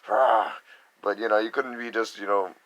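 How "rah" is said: higher, louder gruff yell; imitating a harsh growl; mock tough roar. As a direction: bark it like a hard street kid. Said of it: "and you're like".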